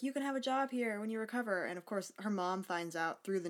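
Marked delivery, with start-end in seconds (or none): 0.0-1.6 high, fast, chirpy manager voice; mock corporate voice. Walmart offering her a job, in a high chirpy voice. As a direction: play the cheery store boss. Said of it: "it's like".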